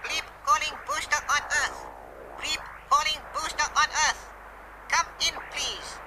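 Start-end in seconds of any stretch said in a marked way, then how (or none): 2.4-4.2 high whispered radio call; whispered alien call. Acted. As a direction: whisper the call through the static.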